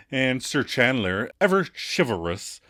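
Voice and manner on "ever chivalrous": usual speaking voice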